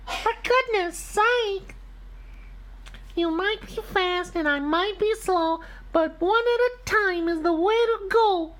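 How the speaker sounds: very high squeaky little truck voice; Blue. Acted. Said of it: "yelled Blue".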